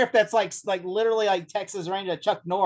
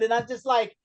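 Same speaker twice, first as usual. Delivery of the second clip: normal talking voice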